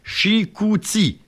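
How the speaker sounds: drawn out, syllable by syllable; slow syllable-by-syllable. Shown as a demonstration. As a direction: stretch the word Chicouti slowly. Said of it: "la troisième syllabe de Chicoutimi". (Chicouti étiré lentement, syllabe par syllabe)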